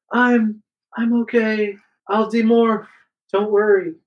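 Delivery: high-pitched voice of a hurt friend; acted reassurance. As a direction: reassure them in a high character voice. Hurt Cassie reassures the others.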